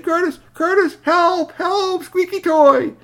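very high, loud cries; high-pitched child's distress call; calling for help. Acted. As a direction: yell urgently for a friend.

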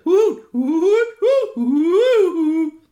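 high, loud owl hooting with a laugh; imitating owl hoots. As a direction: hoot back at the owls.

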